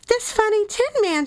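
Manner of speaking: high little queen voice; mouse queen answering. A narrator's reading. Queen tells her mice about the Woodman, high-voiced. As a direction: pitch it right up for the tiny queen.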